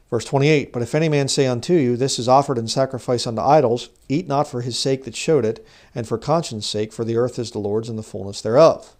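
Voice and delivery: loud, declamatory Bible reading; scripture reading. Acted. Reads scripture aloud to the congregation.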